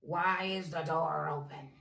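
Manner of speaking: low, loud bear voice; deep gruff Daddy Bear. A narrator's reading. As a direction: boom it out like a big papa bear. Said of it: "in his deep, gruff voice".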